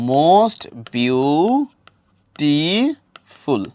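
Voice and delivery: most beautiful drawn out very slowly, beautiful in three parts; syllable by syllable. Shown as a demonstration. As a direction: stretch each beat of most beautiful: most, beau, ti, ful. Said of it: "মানে এরকম করে".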